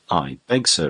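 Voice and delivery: usual speaking voice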